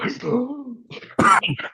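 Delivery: huge high gasp coming up for air; mimicked desperate inhale. Child pulled from the pool by dad, gasping.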